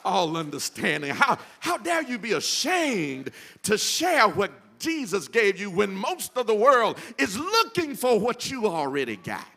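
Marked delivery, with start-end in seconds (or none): 1.1-9.4 louder, pushing rebuke from the pulpit; rising rebuke. Real, not acted. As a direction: challenge them, voice lifting. Preacher scolding the congregation.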